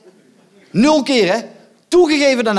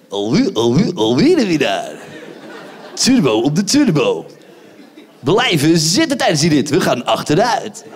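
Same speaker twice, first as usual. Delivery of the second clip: much lower, booming carnival barker; fairground announcer voice; 6 semitones lower than usual. Acted. Comedian showing the audience his fairground barker voice. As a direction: boom it out like the ride operator at the kermis. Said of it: "Ik heb een kermisstem".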